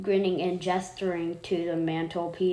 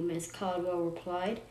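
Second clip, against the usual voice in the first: ordinary delivery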